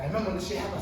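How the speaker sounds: ordinary delivery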